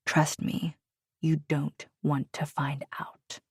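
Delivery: slow, soft menacing whisper; quiet threat. A narrator's reading. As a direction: whisper the threat, barely voiced. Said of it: "His words were quiet".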